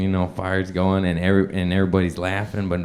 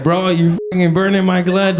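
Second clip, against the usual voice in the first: higher, louder protest; upset buddy; 8 semitones higher, 3 dB louder than usual. Retold or imitated. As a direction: yelp at him to stop. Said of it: "And then he's like".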